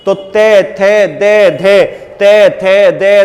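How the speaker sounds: ते थे दे धे called out loud, each syllable apart; loud sound drill. Shown as a demonstration. Teacher drilling students aloud on the dental row. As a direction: bark the dental row out clearly, twice over. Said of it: "तय वर्ग की".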